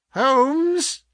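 high call to rouse someone; insistent cry. A narrator's reading. Visitor tries waking the sick man, crying out. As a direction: call out as if to a sleeper. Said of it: "he cried".

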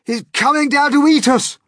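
loud, high terrified wail; panicked wail. Acted. Old grasshopper sees the Cloud Man coming, wailing. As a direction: wail it out in terror. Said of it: "wailed the old green grasshopper".

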